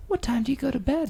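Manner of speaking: imitating a jittery nerdy guy; mocking Scott as a high squeaky Marty McFly type. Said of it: "sound like Marty McFly".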